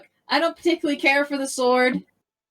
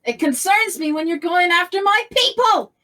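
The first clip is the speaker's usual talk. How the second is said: raised, higher-pitched defiant outburst; indignant confrontation; 5 semitones higher, 4 dB louder than usual. Acted. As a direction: build to an indignant cry. Confronts the villain over their people.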